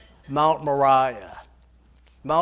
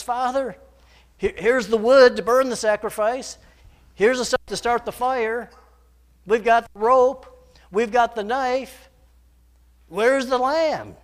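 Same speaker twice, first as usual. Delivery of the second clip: put-on higher, eager voice of a young son; the boy, puzzled, asking his father; Isaac asking; 5 semitones higher, 6 dB louder than usual.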